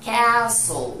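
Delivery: castle said loud as "kas-ol" with the S and L joined; teacher demo. Shown as a demonstration. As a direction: model castle clearly with no "t". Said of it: "la S y la L suenan como dos consonantes unidas".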